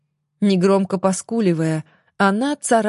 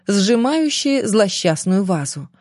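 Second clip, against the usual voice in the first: ordinary delivery, nothing marked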